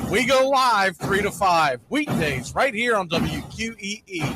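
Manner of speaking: high, punchy radio promo over music; radio promo read. Acted. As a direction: sell the show like a station promo.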